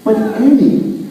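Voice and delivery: whiny, pleading little brother; nasal whine. A narrator's reading. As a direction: whine it like a sulky kid. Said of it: "Joe blubbered and he whined".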